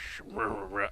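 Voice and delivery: low, soft wordless mumble; mumbling Nick Nolte. Acted. Podcaster mimics an actor's voice, low mumble. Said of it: "it sounds like this".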